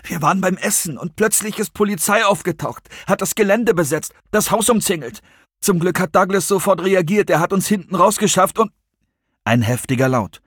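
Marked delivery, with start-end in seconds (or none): 0.0-8.7 high, distraught report; rattled and much higher; close to collapse. A narrator's reading. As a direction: tell it as if about to break down. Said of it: "Er klang, als würde er jeden Augenblick zusammenbrechen".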